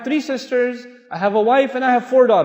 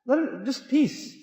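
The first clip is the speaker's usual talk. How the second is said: calming; 6 semitones higher, 3 dB quieter than usual. Retold or imitated. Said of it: "He said".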